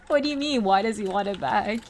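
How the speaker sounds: loud, high disbelief; mock bewildered protest; incredulous. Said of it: "I was like".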